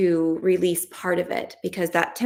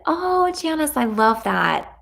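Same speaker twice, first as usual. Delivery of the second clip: much higher, bright excited greeting; delighted; 8 semitones higher, slower than usual. Real, not acted. Coach reacting to Janice's comment, delighted. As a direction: gush over her comment.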